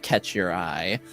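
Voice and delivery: normal talking voice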